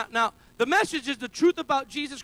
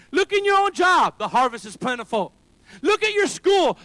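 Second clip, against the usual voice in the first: louder, higher call to look around; preacher's rising call, much louder and higher; preaching shout; 4 semitones higher, 7 dB louder than usual. Real, not acted. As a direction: shout it out to the congregation. Rising shouts between refrain.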